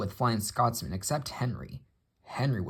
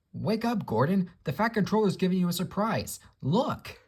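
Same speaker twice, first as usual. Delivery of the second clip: much higher, fast excited call; 9 semitones higher than usual. Acted. Driver wakes Gordon with big news, excited. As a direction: rouse him with eager news. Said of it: "Gordon's driver ran back excited".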